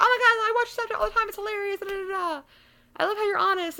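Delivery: high-pitched mock fangirl gushing; gushing fans. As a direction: imitate excited fans in a squeaky-high voice. Imitates gushing Snapchat fans.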